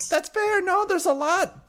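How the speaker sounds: high, loud squeaky voice; very high-pitched. Acted. Excited half-sister answers an apology, very high-pitched. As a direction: blurt it in a squeaky register. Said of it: "in a very high-pitched voice".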